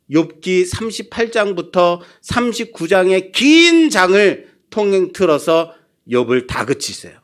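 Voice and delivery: ordinary delivery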